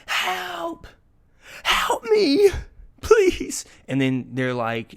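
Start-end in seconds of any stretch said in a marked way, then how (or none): 0.1-3.6 very high, loud cry for help; screaming for help. Pretend. Cowboys fans begging from the gates of hell, screaming for help. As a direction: plead desperately in a mock high scream. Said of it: "screaming for help".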